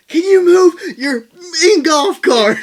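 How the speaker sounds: high, loud, slow, drawn-out yell at the cart behind; hammed-up frustrated holler; mock angry yell. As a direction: yell it high and stretched like a furious golfer stuck on the path. Imitating Blake yelling from cart.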